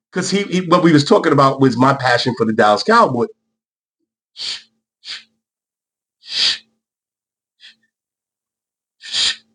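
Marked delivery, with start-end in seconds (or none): 4.3-9.4 soft hushing, breathy shh; quiet shushing to calm himself down. Real, not acted. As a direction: hush yourself, let it go. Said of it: "I'm getting over it".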